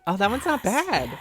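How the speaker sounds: ordinary delivery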